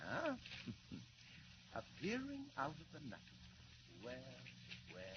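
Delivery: a contemptuous chuckle, then the words; mocking laugh. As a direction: scoff at the silly story through a laugh. Villain mocks the Superman story.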